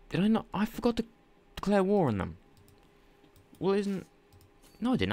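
suddenly realizing a mistake in a panic; surprised panic. Real, not acted. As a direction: gamer panicking over a forgotten war declaration, high and alarmed. Realizes he forgot to declare war.

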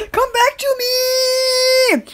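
drawn-out yell for something lost. Real, not acted. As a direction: call it out across the room. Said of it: "I had to yell for my farts".

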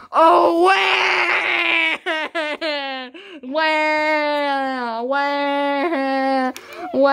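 hammed-up "wah" bawling, long held cries; mock crying. Gamer taunting an opponent who said he's crying, mock wailing.

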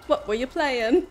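hammy Cockney, lilting up; British accent. Acted. Asking raider about their stream, hammed-up British accent. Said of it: "they asked me to do a British accent".

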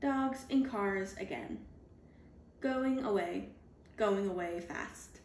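ordinary delivery, nothing marked

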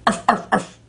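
cute puppy bark; barking like a dog. Pretend. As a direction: yap it out for the kids. Imitates a dog for kids.

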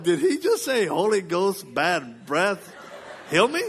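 high-pitched, incredulous questioning; mock disbelief. Son alone on the bed questioning dad's prayer, mock disbelief. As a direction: pitch it right up in comic bafflement.

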